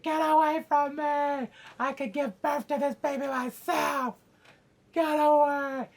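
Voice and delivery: high, loud mock screams; crazed labor screaming. Woman in labor driving off midwives, hammed-up screaming. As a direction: yell it out in hammed-up frenzy. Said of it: "she's going crazy".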